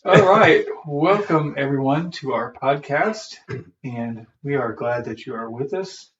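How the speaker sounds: ordinary delivery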